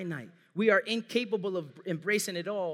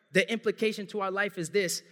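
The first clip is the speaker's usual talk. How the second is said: normal talking voice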